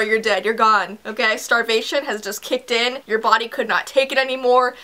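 normal talking voice

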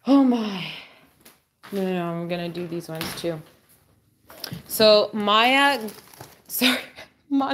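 usual speaking voice

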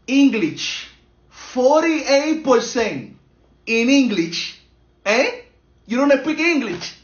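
loud, drawn-out grade reading turning to reproach; indignant scolding. Acted. Father reading his child's English mark, loudly indignant. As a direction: raise the voice in acted outrage.

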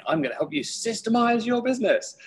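high, chirpy sales-pitch voice; eager consultant. Retold or imitated. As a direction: oversell it like a keen rookie. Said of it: "walk into these businesses going".

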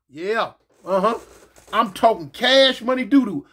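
ordinary talk, nothing marked

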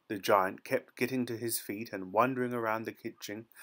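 usual speaking voice

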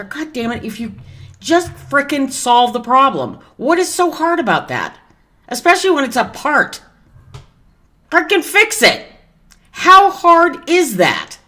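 real exasperation boiling over at customer service; furious venting, climbing into a yell; worked-up rant. Real, not acted. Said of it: "I'm just getting worked up".

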